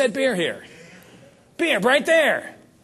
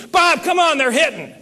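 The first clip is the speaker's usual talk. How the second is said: high, loud call across the water; yell from the dock; 9 semitones higher, 6 dB louder than usual. Retold or imitated. Richard Petty shouting to Bob that the fish are biting, loud call. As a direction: holler for Bob to hurry down. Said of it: "I hear him yell at me".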